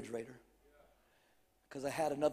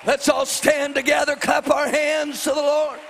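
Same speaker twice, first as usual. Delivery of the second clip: loud, slow, climbing preacher's cry; fiery preaching; 11 semitones higher, 14 dB louder, slower than usual. Acted. Preacher rallying the congregation, loud and defiant. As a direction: build it up and defy Satan.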